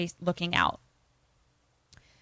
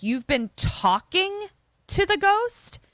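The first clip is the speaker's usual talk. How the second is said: much higher, disbelieving question; incredulous question; 12 semitones higher, 4 dB louder than usual. Retold or imitated. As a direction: voice leaps up in mock disbelief. Retells Daniel's disbelieving question.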